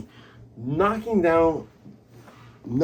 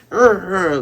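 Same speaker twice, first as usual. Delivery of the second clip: loud, high mock effort grunts; straining grunts; 6 semitones higher, 7 dB louder than usual. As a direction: imitate heaving at something heavy. Imitating Luffy straining.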